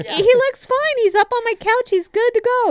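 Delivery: high, sing-song brush-off voice; mock breezy self. Retelling her breezy brush-off.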